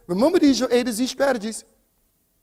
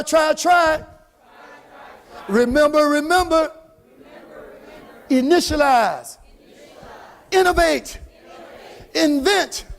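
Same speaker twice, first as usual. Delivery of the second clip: loud, rallying crowd leader; big, forceful call-and-response delivery; motivational preaching; 4 semitones higher, 5 dB louder than usual. Real, not acted. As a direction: fire the crowd up to chant along. Rallies the crowd to chant.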